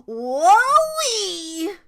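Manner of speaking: high, loud drawn-out whoop; falling whoop. A narrator's reading. Goldilocks falling out of the bed, whooping. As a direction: whoop it as if tumbling.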